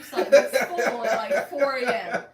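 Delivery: loud, laughing through each line; giggling while she teases. Real, not acted. Teasing her cohost about sleep slots, laughing.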